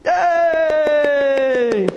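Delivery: one long high yay; crowd cheering. Acted. Pastor voicing crowds backing Jesus against authorities, long high cheer. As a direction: sustain a high mock cheer. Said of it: "the crowds were behind him".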